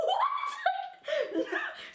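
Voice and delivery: high burst of stunned laughter; disbelieving laugh. Real, not acted. She laughs in disbelief at the taser.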